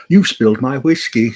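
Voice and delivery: loud, gruff line of a dubbed Italian biker. Retold or imitated. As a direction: quote the dubbed villain, loud and heavy. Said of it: "with the guy's voice when he goes".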